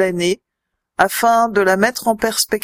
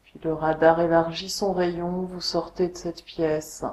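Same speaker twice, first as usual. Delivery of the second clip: guided meditation; 3 semitones lower, 5 dB quieter than usual. Acted. Yoga teacher guiding listeners lying on the floor, meditation voice. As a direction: guide them unhurriedly into deep rest, letting phrases settle. Leads a Yoga Nidra relaxation.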